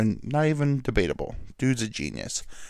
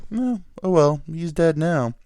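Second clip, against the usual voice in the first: mock-casual shrug pitched high and loud; nonchalant; 6 semitones higher, 5 dB louder than usual. Mimicking an announcer who pronounces a wrestler dead, mock nonchalant. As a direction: toss it off in an exaggerated, high dismissive voice. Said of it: "like, so nonchalant".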